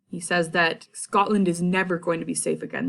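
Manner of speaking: normal talking voice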